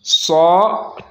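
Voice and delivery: सौ with a thick s and rounded aw; heavy so. Shown as a demonstration. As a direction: fill out सौ, long and back. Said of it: "पुर पढ़ना है".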